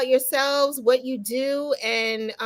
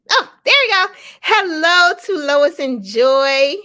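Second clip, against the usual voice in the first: excited greeting; 5 semitones higher, 8 dB louder than usual. Real, not acted. Host greets guests after screen fix.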